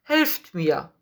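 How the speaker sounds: mild pleading call of a beggar; calling for help. A narrator's reading. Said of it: "streckte er wieder die Hand aus und rief". (verhaltener Hilferuf)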